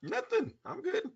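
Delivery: high, soft little voice; tiny high-pitched denial; feigned innocence. Acted. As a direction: squeak it quietly, as if nothing is wrong. Coy denial after asked what's wrong.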